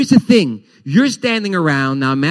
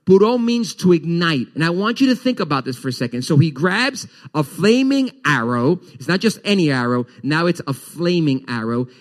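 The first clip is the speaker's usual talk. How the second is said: ordinary talk, nothing marked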